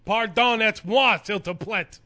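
high, loud put-on French accent; mock French accent. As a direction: overdo a clumsy French accent. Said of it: "You can't do a French accent?".

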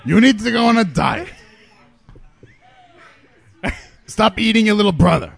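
loud mock taunt, laughing through; laughing insult. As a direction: jeer it out while cracking up. Mocking insults while laughing.

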